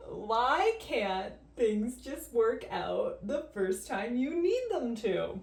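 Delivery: slow, drawn-out, swooping complaint; stretched-out mock-despairing whine; exasperated whine. Real, not acted. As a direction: wail it slowly in frustration. Whining that hairdo fails.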